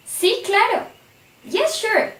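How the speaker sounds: acted keen yes to a plan; cheerful yes. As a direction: answer like a happy friend saying yes. Role-played yes answer in dialogue.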